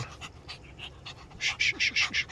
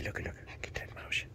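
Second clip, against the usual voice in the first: whisper; 17 dB quieter than usual. Real, not acted. Whispered teasing.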